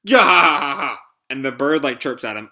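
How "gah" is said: loud, drawn-out acted cry; loud outburst. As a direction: burst out with a big open "gah". Outburst during attack on Pino.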